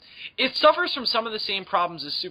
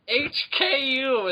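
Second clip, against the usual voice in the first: announcer voice; 9 semitones higher, much slower than usual. Acted. Announcer voice continues.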